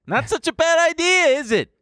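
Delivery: high-pitched mocking sing-out; taunting. Mocking crypto's anti-bank idea.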